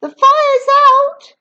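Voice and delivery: high, loud, slow proclamation; proud announcement. A narrator's reading. Grandma declaring the fire out, proud high voice. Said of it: "Grandma announced proudly".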